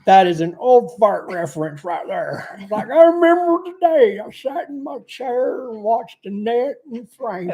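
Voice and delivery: put-on slow, creaky old-timer voice; old man voice. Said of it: "you can stop using your old man voice now".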